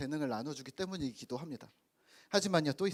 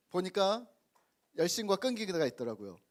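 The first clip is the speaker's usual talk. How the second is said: ordinary talk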